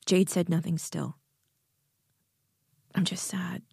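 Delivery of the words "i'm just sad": hushed, half-whispered sadness. A narrator's reading. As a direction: let the sadness out in a near-whisper. Jade finally admits she is sad.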